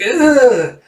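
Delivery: high, loud "ugh" of revulsion; squirming mock groan; acted disgusted groan. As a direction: let out a high, hammed-up yelp of disgust. Voices character's disgusted reaction.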